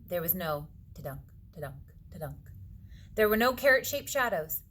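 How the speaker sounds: usual speaking voice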